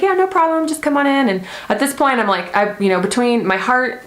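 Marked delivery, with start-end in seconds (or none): 0.0-1.3 much higher, chirpy clinic staff voice; breezy receptionist. Retold or imitated. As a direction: lightly mimic a cheerful nurse. Voicing clinic staff reply.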